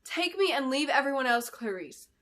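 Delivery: a moderate yell over the din. Acted. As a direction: call out over the noise. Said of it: "Belinda yelled over Edeline".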